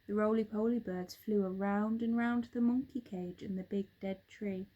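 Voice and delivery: ordinary delivery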